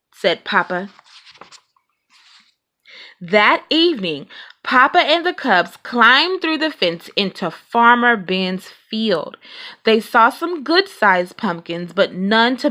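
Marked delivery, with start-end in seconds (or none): none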